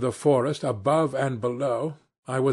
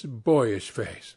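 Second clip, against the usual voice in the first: ordinary delivery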